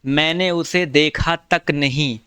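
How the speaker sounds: slow, word by word, stressing उसे; teacher spelling out the example sentence at half speed; slow demo reading. Shown as a demonstration. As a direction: read it out slowly so students catch each word. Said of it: "इस वाक्य में क्रिया विशेषण है".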